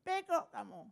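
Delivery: high squawky parrot copying the insult. Retold or imitated. Parrot repeating the insult to the driver, high squawk.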